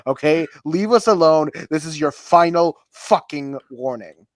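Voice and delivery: emphatic pretend outrage; mock final warning. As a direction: order them off, hammed up. Reading an angry final warning.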